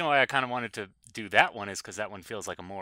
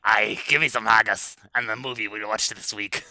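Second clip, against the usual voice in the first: louder, hammed-up Scottish accent; 5 dB louder than usual. Host introducing this week's movie, mock Scottish accent. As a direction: do a comic stage Scot. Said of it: "can I do Scottish?".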